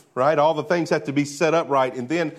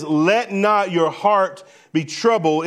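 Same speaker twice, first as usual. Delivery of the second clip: firm, slow, emphatic imperative; emphatic command; 3 semitones higher, slower than usual. Acted. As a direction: lay it down as an order, slowly. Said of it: "it is an emphatic, imperative statement".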